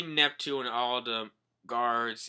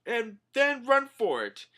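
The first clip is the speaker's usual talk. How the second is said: high, loud whiny voice; panicky Usopp; 12 semitones higher, 8 dB louder than usual. Retold or imitated. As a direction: plead your innocence in a panic. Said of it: "Usopp's like".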